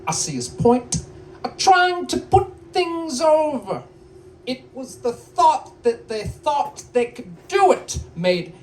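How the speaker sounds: slow, swooping theatrical poem reading; dramatic poem recitation. Acted. Performer reciting Dream Song One to a live audience, theatrical recitation. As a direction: declaim the poem with big pitch leaps and long pauses.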